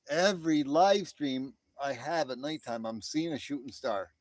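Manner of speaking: usual speaking voice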